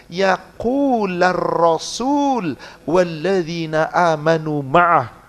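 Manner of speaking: slow chanted Arabic recitation; Quran recitation. Acted. Preacher reciting the verse to the congregation, slow chant. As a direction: intone the verse slowly like tilawah.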